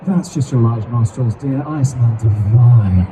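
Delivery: low, loud, self-satisfied dismissal; nonchalant egotist. Shown as a demonstration. Bard dismissing a perfume complaint, nonchalant. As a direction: brush it off, drawing out the last words. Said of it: "could be very nonchalant".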